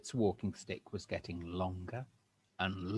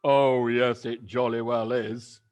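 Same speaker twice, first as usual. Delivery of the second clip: gloating Mister Twit; 9 dB louder than usual. Acted. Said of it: "said Mister Twit".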